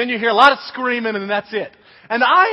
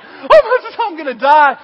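high, loud mock panic; hammed-up terrified wail; ballistic panic; 6 semitones higher, 9 dB louder than usual. Scared kid sure he will die, hammed-up panic. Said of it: "I was ballistic".

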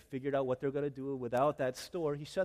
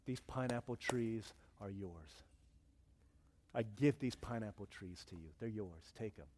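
subdued, lower, resigned surrender to God; retold prayer; 4 semitones lower, 5 dB quieter than usual. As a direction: pray it softly, letting go. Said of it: "he started praying".

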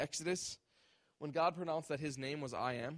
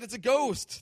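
higher and louder, disciples crying out in alarm; mock frightened cry; 8 semitones higher, 9 dB louder than usual. A narrator's reading. Preacher voicing terrified disciples, mock fearful cry. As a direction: shout it like terrified sailors. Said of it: "cried out in fear".

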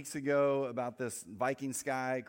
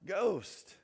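mild retold yell, pitched up; mock yell of alarm; 5 semitones higher than usual. Disciples yelling out at something on the water, mild mock yell. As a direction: call it out like a startled crew, half-hearted. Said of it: "so they yell out".

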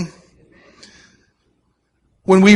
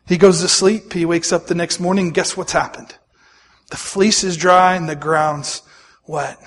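normal talking voice

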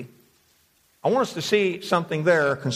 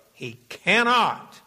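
slow, higher, weighty "cannot"; stress on "cannot"; 4 semitones higher, slower than usual. Real, not acted. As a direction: draw out "he cannot" with firm emphasis. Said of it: "And I emphasize the word".

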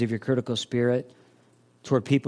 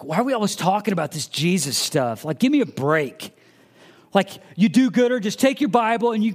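annoyed skeptic; 8 semitones higher than usual. Retold or imitated.

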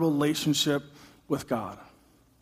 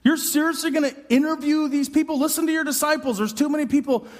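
much higher, exasperated pleading; frustrated; 8 semitones higher than usual. Retold or imitated. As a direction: plead in disbelief, fed up. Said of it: "you're frustrated".

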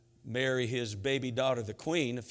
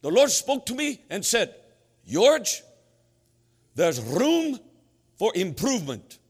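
high, loud Norwegian proclamation; mock-solemn Norwegian accent with dramatic pauses; 9 semitones higher, 8 dB louder than usual. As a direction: deliver the big reveal as a grand Norwegian preacher. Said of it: "And he said".

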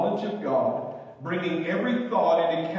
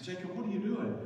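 hushed dad; 3 semitones higher, 8 dB quieter, faster than usual. Retold or imitated. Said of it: "and I said".

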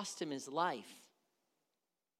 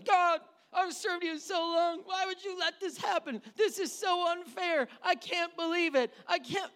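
higher whiny lament; mock an aggrieved believer; complaining to God; 7 semitones higher than usual. Imagined believer griping at God, higher. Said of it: "we'd be going".

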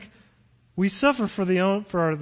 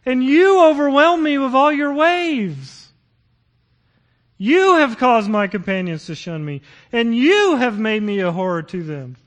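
loud, anguished, rising psalm reading with slowed peaks; pleading psalmist crying out to God, loud and anguished; accusing lament; 7 semitones higher, 9 dB louder than usual. Acted. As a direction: read it as a raw accusation against God. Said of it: "listen to how the psalmist points the finger at God".

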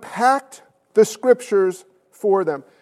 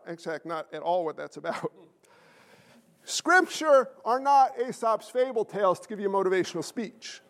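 ordinary delivery

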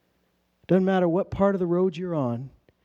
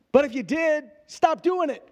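much higher, playful stern warning; pointed warning; 8 semitones higher, 4 dB louder than usual. Real, not acted. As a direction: jump up high to scold them lightly. Preacher's pointed warning aside.

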